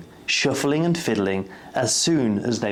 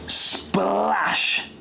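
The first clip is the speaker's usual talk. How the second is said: splash; 6 semitones higher than usual. A narrator's reading. Splash sound effect.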